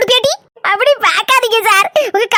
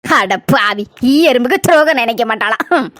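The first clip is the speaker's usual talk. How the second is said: much lower, scoffing mutter to herself; sarcastic aside; 10 semitones lower than usual. Acted. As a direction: scoff under your breath. Sarcastic aside mocking claim. (கேலியான தனிப்பேச்சு)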